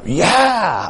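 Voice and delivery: high-pitched eager yes; excited. Retold or imitated. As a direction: agree eagerly, pitch leaping up. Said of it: "I got excited in my heart".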